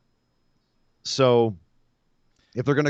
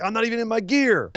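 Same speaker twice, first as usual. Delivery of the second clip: mock whiny Johnny; 9 semitones higher than usual. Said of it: "Johnny's like".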